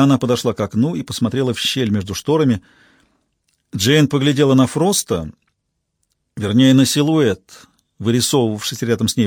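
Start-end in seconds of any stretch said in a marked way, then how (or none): none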